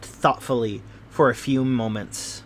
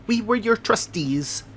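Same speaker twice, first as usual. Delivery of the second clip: a touch higher, fussy little man telling his tale; Thaddeus Sholto, lightly voiced; 8 semitones higher than usual. Little man explains the treasure to his guests, lightly voiced. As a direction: lightly play the nervous little host.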